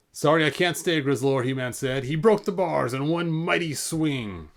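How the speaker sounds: lively read-aloud to an audience; animated picture-book narration; storybook reading. Acted. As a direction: tell it like a bedtime adventure.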